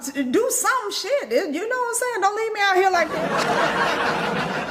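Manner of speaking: high, slow mock begging with a laugh; pleading to spirits. She begs the spirits on the phone, high and slow. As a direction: beg the spirits half laughing. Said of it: "I be on the phone like".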